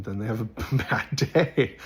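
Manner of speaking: laughing through the words; amused retort. Retold or imitated. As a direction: laugh off the objection as you say it. Laughs while retelling his retort.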